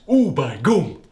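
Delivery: loud mock northern English cry; northern exclamation. A narrator's reading. Darren climaxing, loud northern English cry. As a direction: blurt it like a Yorkshire lad.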